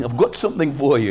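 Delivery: a touch of imitation British man; British accent. Preacher repeats the British stranger's offer to the congregation, in a mock British accent. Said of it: "it was a British guy".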